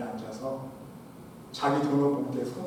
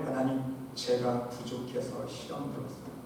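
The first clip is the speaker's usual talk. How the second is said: humble confession; 4 semitones lower, 9 dB quieter than usual. Shown as a demonstration. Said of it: "우리는 어떻게 고백해야 돼요?".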